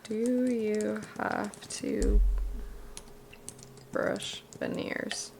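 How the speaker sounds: drawn-out, hushed, mock-grave; a hint of whispered disbelief; slow deadpan question. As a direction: let it hang, slowly and softly. Mock-serious repeat of question.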